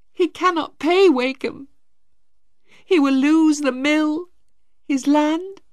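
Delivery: put-on high, tearful girl's voice, close to tears; a mild acted sob in the words as she tells him; crying. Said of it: "Maggie started to cry".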